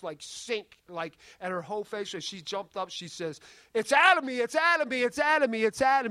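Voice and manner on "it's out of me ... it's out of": high, urgent repeated exclamation; retold jubilant cry. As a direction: imitate her crying out in release. Retold cry of woman being delivered.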